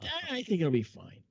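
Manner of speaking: put-on cocky young self; breezy confidence. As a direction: shrug it off. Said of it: "And I said".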